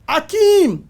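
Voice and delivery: loud, high call across the set. Retold or imitated. Producer calling an actor to set, in a loud high call. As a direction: shout the name to summon him.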